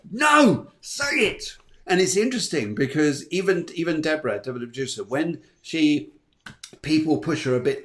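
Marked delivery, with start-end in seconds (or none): 0.1-1.6 high, loud no, say it; emphatic urging. Real, not acted. Host urges Libra listeners to speak out, high loud urging. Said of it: "urging listeners".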